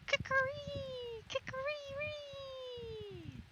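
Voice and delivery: high, slow drawn-out cock crow; rooster crow. Acted. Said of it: "cried down from the beam".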